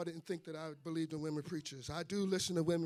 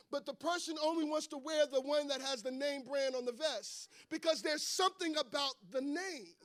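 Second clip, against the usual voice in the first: raised pitch, building to a loud proclamation; impassioned preaching; 6 semitones higher, 5 dB louder than usual. Real, not acted. Preacher on help in the Lord's name, impassioned preaching. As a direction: build the point up for the congregation.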